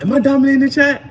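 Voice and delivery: high, fast mock question from a viewer; teasing chat quote. Reader teases a chatter by mimicking her high, fast question. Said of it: "Jessa was in here like".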